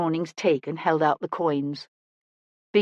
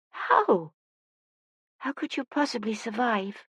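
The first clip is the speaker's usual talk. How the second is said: put-on high, soft, incredulous child; high, quiet young voice full of wonder; bewildered child; 4 semitones higher, 6 dB quieter than usual. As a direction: ask in small-voiced disbelief. Upset child questions blind woman.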